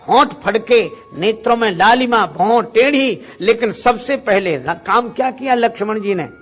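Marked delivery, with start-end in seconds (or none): none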